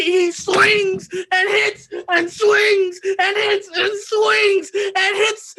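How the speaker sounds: slow, high, crying shout; confessing the blows, crying shout; sobbing shout. Acted. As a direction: hammer each word as he breaks down. Describing repeated blows.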